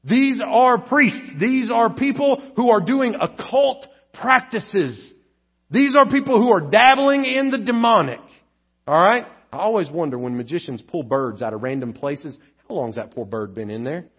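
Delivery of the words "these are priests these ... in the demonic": slow, higher, emphatic pulpit voice; preacher stressing every word; forceful preaching. Acted. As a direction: drive the warning home from the pulpit.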